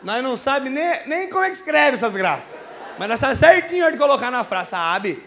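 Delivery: excited rant. Acted. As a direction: pump it up, proud and fired up. Proud rant about interior dialect. (discurso empolgado e cada vez mais alto)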